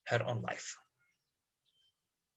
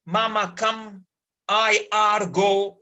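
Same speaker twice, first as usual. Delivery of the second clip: broken pidgin English; 5 semitones higher, 5 dB louder, slower than usual. Shown as a demonstration. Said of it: "Pidgin English broken".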